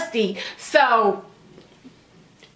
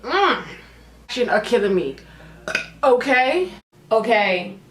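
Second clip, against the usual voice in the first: ordinary talk, nothing marked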